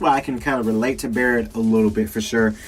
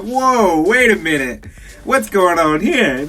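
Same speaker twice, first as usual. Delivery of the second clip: raised, startled double-take; higher, mock-scandalized disbelief; shocked reaction; 5 semitones higher, 4 dB louder, slower than usual. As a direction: replay your alarmed surprise. Acting out shock at the scene.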